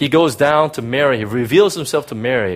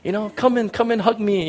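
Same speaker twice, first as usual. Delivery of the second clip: softer, higher gentle welcome; a softer voice for the Jesus you'd expect; imagined tender Jesus; 8 semitones higher, 3 dB quieter than usual. Retold or imitated. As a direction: invite her in kindly. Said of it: "You think that Jesus would say".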